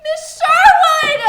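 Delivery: high shrill cry for teacher; shriek. Acted. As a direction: wail for the teacher.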